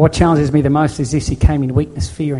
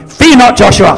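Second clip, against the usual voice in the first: higher, much louder furious shout; angry command; 7 semitones higher, 11 dB louder than usual. Retold or imitated. God angrily shouting at Joshua before battle, furious shout. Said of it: "getting angry and going".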